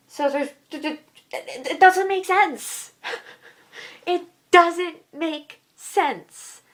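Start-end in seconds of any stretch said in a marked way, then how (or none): 1.7-2.9 higher-pitched burst of frustration; exasperated. Real, not acted. Exasperated repeat of same words. 4.1-6.6 slow, with pauses between words; word-by-word emphasis. Real, not acted.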